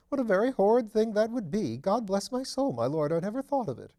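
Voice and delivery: be shocked at the thought; aghast. Acted. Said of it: "he said with some agitation".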